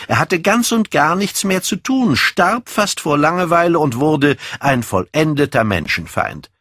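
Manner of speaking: put-on animated young man, pitch often leaping up; lightly voiced, ardent youth holding forth; self-possessed young man.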